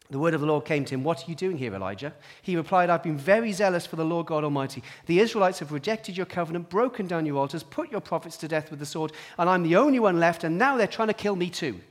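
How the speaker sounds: loud, declaiming Bible reading; forceful scripture reading. Acted. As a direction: proclaim the passage with force.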